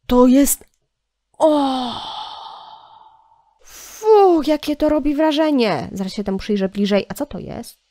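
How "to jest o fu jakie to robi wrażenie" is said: impressed gasp. Real, not acted. Impressed exclamation.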